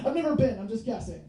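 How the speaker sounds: ordinary delivery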